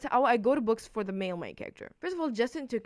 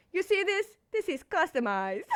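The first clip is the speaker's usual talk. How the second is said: much higher and louder; excited showing off; 9 semitones higher, 5 dB louder than usual. Real, not acted. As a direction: show it off proudly. Showing the customized book eagerly.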